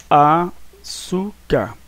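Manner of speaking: slow, split into a, çú, car; syllable by syllable. Shown as a demonstration. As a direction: pause between each syllable of "Açúcar". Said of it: "você separa aqui".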